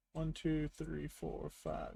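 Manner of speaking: muttered count under breath; counting quietly. Real, not acted. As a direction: count softly to yourself.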